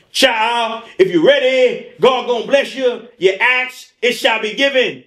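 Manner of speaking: high, sing-song preaching cadence; preacher impression. Pastor preaching to the congregation, high and rhythmic.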